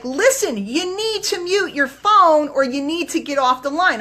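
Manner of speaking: retelling a stern warning to noisy callers; mildly irritated warning. Retold or imitated. Host scolding noisy callers on the call, mildly annoyed. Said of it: "I'm, I'm pissed off".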